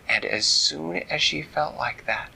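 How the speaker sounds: plain reading voice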